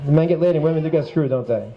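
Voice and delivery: quick, low aside; offhand check. Real, not acted. Host double-checking his category title, quick low aside.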